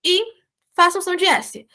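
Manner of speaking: ordinary talk, nothing marked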